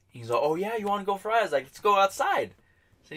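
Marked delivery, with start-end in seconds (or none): 0.4-1.4 high-pitched mocking challenge; retold taunt. Rival daring him to go outside, high taunt. Acting out the other man's taunt. 1.6-2.5 high, insistent dare; retold fight challenge. Answering a rival's invite to fight, high challenge.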